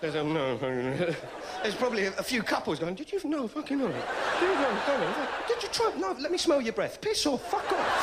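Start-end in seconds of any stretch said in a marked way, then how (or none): 2.9-8.0 defensive denial. Pretend. Couple denying, high and loud.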